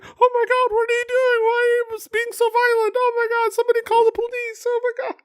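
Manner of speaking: deadpan high-pitched mock panic; calm mock yelling. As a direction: squeal the alarm out calmly, as if filming on a phone. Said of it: "Just calmly yelling that".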